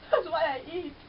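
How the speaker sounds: ordinary talk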